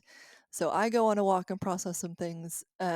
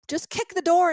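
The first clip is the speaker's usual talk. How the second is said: mock bossy advice; 9 semitones higher, 8 dB louder than usual. Facebook commenters' advice.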